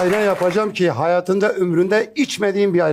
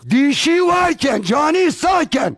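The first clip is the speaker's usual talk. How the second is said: shouting; 8 semitones higher than usual. Acted. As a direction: bellow it like a street crier. Osman's shouted folk saying.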